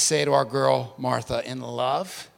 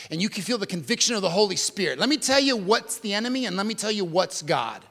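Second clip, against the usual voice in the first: ordinary talk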